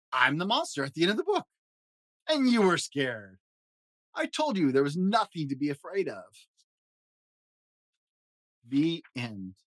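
high fuzzy Muppet monster, jittery and fretful; Grover voice. Acted. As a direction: play a nervous, lovable blue monster. Grover voice reading story.

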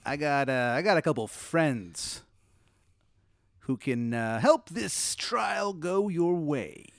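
sly genie offer, slowing right down at the end. Acted. Genie offering friends to fix a trial, drawn-out and insinuating. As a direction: draw out the last words as a shady offer. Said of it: "he goes".

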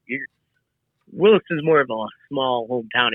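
ordinary talk, nothing marked

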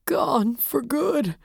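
put-on high, slow, desolate old man; cracked and desolate. A narrator's reading. Grieving husband repeats it to the detective, slowly, cracked and desolate. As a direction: drag it out high with a breaking voice. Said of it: "his voice cracked and desolate".

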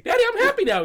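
very high, loud little-kid impression; mock happy child. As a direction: squeak it with creepy fake cheer. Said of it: "And she, and she closed the door".

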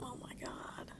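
terrified whisper. Acted. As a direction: breathe it out in fear. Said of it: "I'm gonna whisper".

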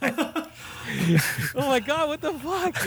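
high, drawn-out laughing disbelief; laughing mock disbelief. Viewer seeing the mole crushed, laughing disbelief. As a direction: stretch it out in laughing shock.

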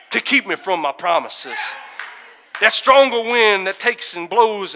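loud fiery sermon voice; shouting preacher at full force; full-force preaching. Acted.

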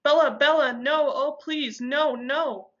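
high, anguished pleading; agonized. A narrator's reading. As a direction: cry out in agony, begging her. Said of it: "agony in his perfect voice".

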